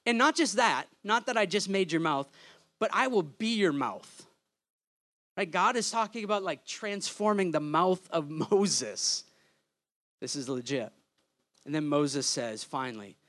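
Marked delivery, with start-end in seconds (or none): none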